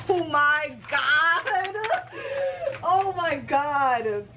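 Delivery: slow, high, drawn-out delight; overjoyed. Real, not acted. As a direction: stretch the words out, laughing with joy. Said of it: "I'm so happy right now".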